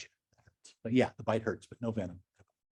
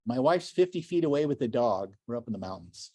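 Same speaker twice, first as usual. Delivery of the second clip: usual speaking voice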